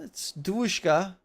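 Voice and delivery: hammed-up Russian accent; fake Russian accent. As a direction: put on a Russian accent, emphatically. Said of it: "why I put fake Russian accent".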